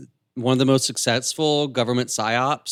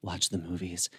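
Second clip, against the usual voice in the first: sultry voice; 6 semitones lower, 12 dB quieter than usual. Acted. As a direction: draw it out low and sultry. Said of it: "in a really sultry voice".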